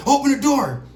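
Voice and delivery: high, loud cop command; cop order. Retold or imitated. Cop ordering him to knock: loud high command. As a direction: order it sharply. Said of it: "he was like".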